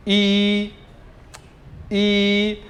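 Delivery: ई as one long, steady vowel; pinyin yi. Shown as a demonstration. Teacher leading students' practice, long steady ई. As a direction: hold ई long for learners to copy. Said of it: "आप मेरे साथ साथ pronounce करें".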